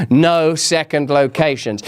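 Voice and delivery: "No second locations" low and slow; firm mock warning. Joking warning, repeated for emphasis.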